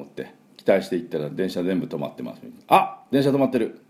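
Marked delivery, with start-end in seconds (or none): 2.7-3.8 sudden throaty 「あ」 of a small shock, higher; surprised ah. Shown as a demonstration. Teacher shows the surprised 「あ」 of finding trains stopped. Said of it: "こんな感じですね".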